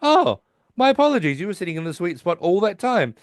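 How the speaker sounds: mock politely apologize while displacing them; prim Sheldon apology. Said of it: "And he goes".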